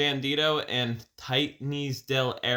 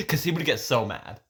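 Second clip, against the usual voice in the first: usual speaking voice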